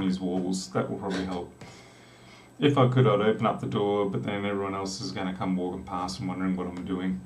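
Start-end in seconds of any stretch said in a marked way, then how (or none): none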